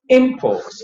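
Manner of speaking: Imports with a high IM, falling on ports; first syllable high. Shown as a demonstration. Teacher demonstrating the noun for learners, high first syllable on Imports. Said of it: "first syllable high".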